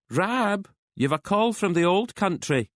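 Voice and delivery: calling out. A narrator's reading. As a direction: call across the house to her husband. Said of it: "calling out for her husband".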